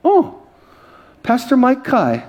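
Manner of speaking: high-pitched "oh" of recognition; lady recognizing pastor. Retold or imitated. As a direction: imitate an agent suddenly recognizing who it is. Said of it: "She looks".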